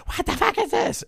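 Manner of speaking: high-pitched mock outrage; angry girlfriend, imitated. Girlfriend confronts him over photo.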